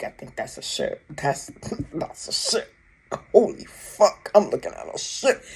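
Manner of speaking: hushed, breathy disbelief with a small laugh; awed whisper. Retold or imitated. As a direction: whisper it, stunned at what you see. Retelling own astonished realization.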